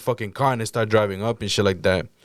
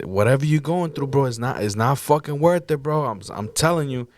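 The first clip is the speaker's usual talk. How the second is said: higher, urgent re-enacted shouting, not full force; retold yelled plea; 5 semitones higher than usual. Talking friend out of jumping, retold yelled plea. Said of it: "So I just start yelling at him".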